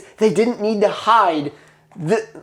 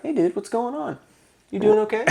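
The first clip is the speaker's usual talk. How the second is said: soft, laid-back mock snake voice; chill; 7 dB quieter than usual. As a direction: casually check in, much softer. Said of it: "he was chill".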